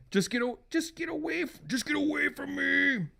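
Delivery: high acted metal-singer plea; tormented husband. Husband sends wife away, high and tormented.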